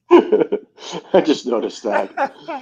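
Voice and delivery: high, slow words squeezed out through laughter; can barely get it out for laughing; laughing hard. Real, not acted. Cracking up at the commercial.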